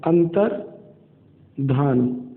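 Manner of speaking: अंतर्धान drawn out very slowly syllable by syllable; slow emphatic. Shown as a demonstration. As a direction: stretch out अंतर्धान slowly so students remember. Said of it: "ध्यान रखेंगे". (छात्रों को याद कराने के लिए अंतर्धान बहुत धीरे खींचकर)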